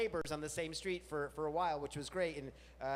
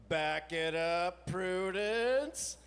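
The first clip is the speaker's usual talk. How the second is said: slow, louder, rising on Prudence; firm command to dog; 2 semitones higher, 6 dB louder, much slower than usual. Retold or imitated. Owners calling off the begging dog, slow and loud. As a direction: drawl it out, not even looking up. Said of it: "They'd just go".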